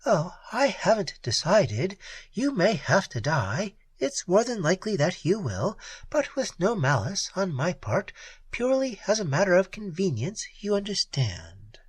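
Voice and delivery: a hint of the cool Red Peri; lightly voiced Peri. A narrator's reading.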